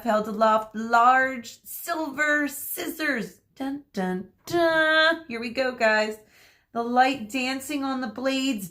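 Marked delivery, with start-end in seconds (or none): 3.5-5.2 mock suspense sting. Teacher teases the scissors' arrival with a mock suspense sting. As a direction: play the dramatic cue with your voice.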